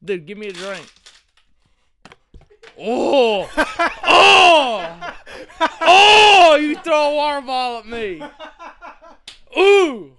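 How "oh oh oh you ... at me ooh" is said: loud, high yelps with laughter; surprised shouts. Real, not acted. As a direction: yelp as the bottle hits. Hit by a water bottle.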